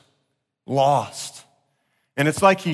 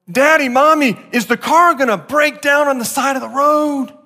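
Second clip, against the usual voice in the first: put-on child's voice; 8 semitones higher, 4 dB louder than usual. Imagined kids asking worried question.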